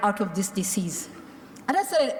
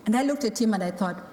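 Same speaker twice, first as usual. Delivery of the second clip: normal talking voice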